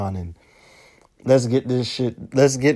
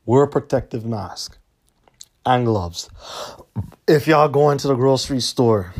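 ordinary talk

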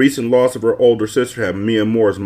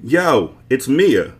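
higher, louder call-out; yelling; 6 semitones higher, 3 dB louder, much slower than usual. A narrator's reading. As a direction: shout it through the intercom. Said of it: "Mia Moore yelled loud enough".

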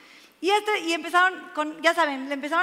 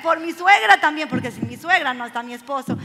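normal talking voice

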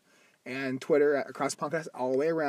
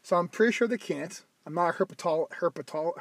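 ordinary talk

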